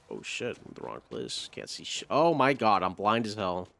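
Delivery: ordinary delivery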